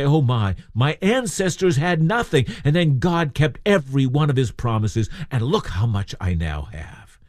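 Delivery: acting out a thankful giver, lifted then easing down; grateful amazement. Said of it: "Rather, this was a time to say".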